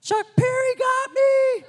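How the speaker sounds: very high, loud, slow joyful scream; ecstatic shout. Retold or imitated. Pastor to his wife after the tunnel, ecstatic high shout. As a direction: yell it out overwhelmed with joy. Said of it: "and I was like".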